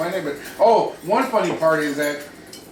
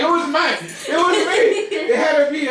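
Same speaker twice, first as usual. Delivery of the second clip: laughing retelling; 11 semitones higher than usual. Retold or imitated. Imitating friend reacting to misspelt sign.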